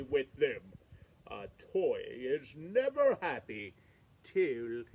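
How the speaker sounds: the king asking a favour, low and earnest; island king. Acted.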